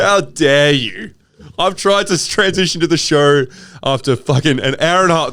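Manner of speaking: hammed-up how dare you; mock outrage. Mock outrage at being corrected.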